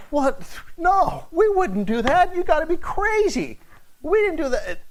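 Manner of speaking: high, put-on voice of indignant brothers; mock-indignant denial, pitched high; protesting brothers. Brothers denying the charge to the governor, high and indignant. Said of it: "Joseph's brothers are thinking".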